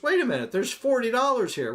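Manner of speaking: high-pitched mock suspicion; acted wifely challenge, pitched well up; suspicious wife. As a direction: catch him out like the wife in a joke.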